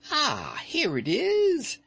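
high, slow joyful cry of discovery; old witch finding her prize, joyfully crying. A narrator's reading. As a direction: cry it out with delight. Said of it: "she cried joyfully".

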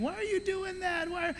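high, soft puzzled dad; sounding like my dad. Retold or imitated. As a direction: mimic your baffled dad. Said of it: "I sound like my dad".

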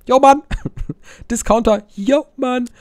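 high, loud "Yo, Mann"; loud hype call. Real, not acted. Hyping up a fan who loves his videos, shouting.

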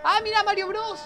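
high, loud delighted cry; excited shout. Real, not acted. Excited reaction to show. (grito emocionado y agudo)

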